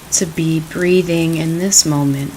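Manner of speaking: calm, unhurried meditation voice; guided meditation. Acted. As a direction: ease them gently into the practice.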